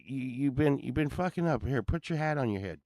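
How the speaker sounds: ordinary delivery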